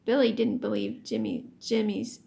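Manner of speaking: ordinary delivery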